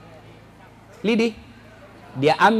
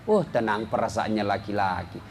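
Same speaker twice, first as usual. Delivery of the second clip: ordinary talk, nothing marked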